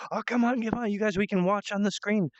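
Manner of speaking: high-pitched eager urging; rally the others excitedly; Millie. Acted. Acting a girl calling friends to watch, eager. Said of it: "and then she's like".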